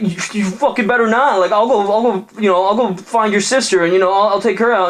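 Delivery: high, hammed-up jock threat; threatening bro. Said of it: "the bro's like".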